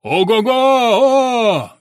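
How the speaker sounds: long loud high whoop; hollering. A narrator's reading. Pafnutyev yelling back to the distant caller, loud. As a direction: holler out to be heard far. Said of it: "и заорал".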